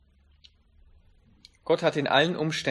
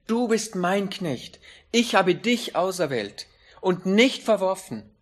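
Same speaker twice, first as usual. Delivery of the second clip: emphatic proclamation; 5 semitones higher, 5 dB louder than usual. Acted. God's promise proclaimed.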